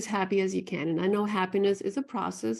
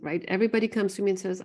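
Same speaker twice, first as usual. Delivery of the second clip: normal talking voice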